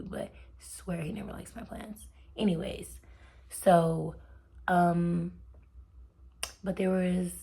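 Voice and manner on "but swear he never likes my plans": "swear he never likes my plans" breathed in a soft whisper; hushed, confiding whisper; whispered aside. Shown as a demonstration. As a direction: drop to a secret whisper. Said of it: "I'm gonna say that again".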